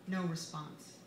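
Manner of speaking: usual speaking voice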